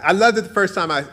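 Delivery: usual speaking voice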